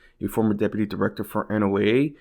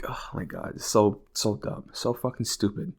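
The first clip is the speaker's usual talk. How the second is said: usual speaking voice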